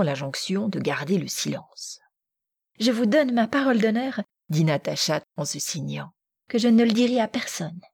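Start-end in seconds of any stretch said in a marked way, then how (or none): 2.8-4.2 put-on higher, earnest girlish promise; promesse empressée. A narrator's reading. Natacha promet solennellement le secret. 6.5-7.8 put-on higher, earnest girlish promise; promesse empressée. A narrator's reading. Suite de la promesse de Natacha.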